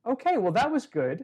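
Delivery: put-on suddenly high, wrap-it-up kid voice; brisk child teacher. Child ends meditation after fourteen seconds, brightly brisk. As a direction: imitate a kid teacher, pitched well up.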